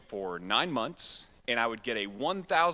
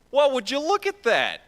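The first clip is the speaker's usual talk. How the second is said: high, loud sing-song tease; teasing remark; 11 semitones higher, 8 dB louder than usual. Retold or imitated. Pastor teasing the young man about the girl, sing-song. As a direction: play a playful pastor nudging his friend.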